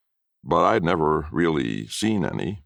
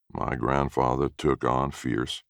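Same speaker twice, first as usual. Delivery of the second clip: usual speaking voice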